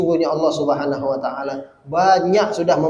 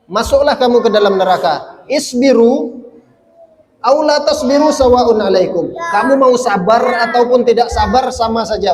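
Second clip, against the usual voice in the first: loud, high, emphatic proclamation; stern command; 7 semitones higher, 6 dB louder than usual. Retold or imitated. Preacher quotes God addressing people in hell, stern command. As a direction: thunder it out as a divine verdict. Quoting God's order to the damned.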